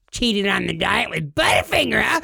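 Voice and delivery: accusing wife. Retold or imitated. Wife confronting over receipt.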